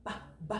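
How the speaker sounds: "B" as a short low "buh", twice; letter sound. Shown as a demonstration. Teacher showing the B sound, "B" as short "buh". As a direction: say "B" as its quick sound, softly. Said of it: "Make the B sound".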